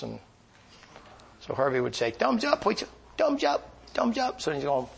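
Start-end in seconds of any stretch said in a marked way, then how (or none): 2.1-4.4 high, bright repeated chant; Herbie's cheerful thumbs up. Retold or imitated. Herbie cheering thumbs up to the preacher, high and bright.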